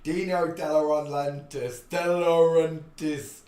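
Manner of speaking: "De Laurentiis" spelled out slowly and loudly, syllable by syllable; careful attempt at a name. Shown as a demonstration. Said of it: "I can't even pronounce all those vowels".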